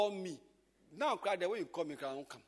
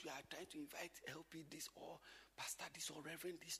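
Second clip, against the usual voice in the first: whispered request; 4 semitones lower, 17 dB quieter than usual. Retold or imitated. Quoted polite request to pastor.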